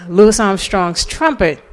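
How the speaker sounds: ordinary talk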